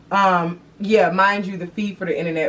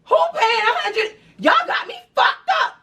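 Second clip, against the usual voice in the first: rising, laughing, higher incredulous outrage up to a shout. Retold or imitated. To the internet company, laughing higher outrage rising into a shout. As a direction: laugh it off, then blow up. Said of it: "So first I had tried the Naker side".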